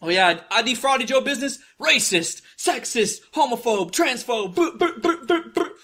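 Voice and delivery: high mocking volley of accusations; mock accuser. Mimics criminal firing off accusations.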